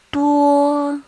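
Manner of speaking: Duo held high, level and loud; first tone. Shown as a demonstration. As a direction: say Duo on one steady high note. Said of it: "this is how we mark the tones".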